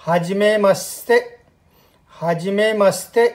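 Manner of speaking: gentle careful हाजिमे मस्ते to copy; slow model phrase. Shown as a demonstration. Teacher to learners, modelling the first meeting greeting: slow. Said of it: "जिस तरह से मैं बोल रहा हूँ".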